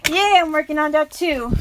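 normal talking voice

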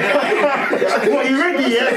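high giggly retelling, laughing through it; can't stop laughing telling the fight story. Real, not acted. Laughing as he tells friends about the school fight.

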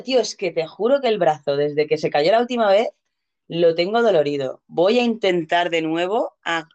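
ordinary delivery

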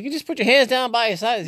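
high, loud panicky racer; flustered yelp, hands flailing; Ricky Bobby panicking. Retold or imitated. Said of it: "Remember Ricky Bobby".

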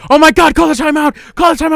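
frantic plea. Retold or imitated. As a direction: beg the coach to call it. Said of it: "was like".

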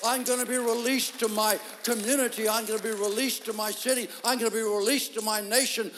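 loud, building sermon cadence; fervent preacher's call; preaching. Acted. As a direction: preach it out to the congregation. Preaching at full force.

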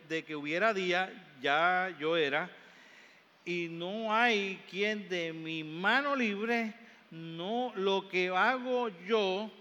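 slow, deliberate scripture reading. Acted. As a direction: read each phrase slowly and solemnly. (lectura bíblica lenta y solemne)

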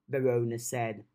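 ordinary delivery

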